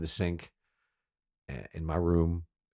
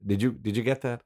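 usual speaking voice

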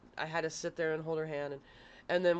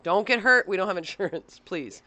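teasing warning; 4 semitones higher, 11 dB louder than usual. Retold or imitated. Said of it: "I would always tease the kids".

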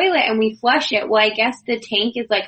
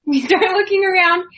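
laughing; 9 semitones higher than usual. Real, not acted. Laughing restart of same line.

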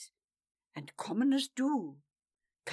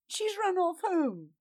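higher, excited gossip; 9 semitones higher, 5 dB louder than usual. A narrator's reading. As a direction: spread the gossip. Gossip in crowd.

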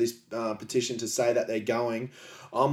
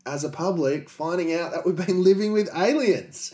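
mock excitement; 7 semitones higher, 6 dB louder than usual. Excited alien disclosure announcement.

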